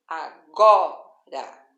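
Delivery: "agora" very slow, split, with a loud GO; a... GO... ra, punching the middle of "agora"; teacher isolating the strong GO in "agora"; stress on next-to-last syllable. Shown as a demonstration. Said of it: "tem como sílaba tônica a penúltima".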